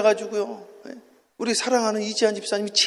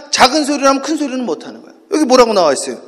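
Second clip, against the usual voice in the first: ordinary talk, nothing marked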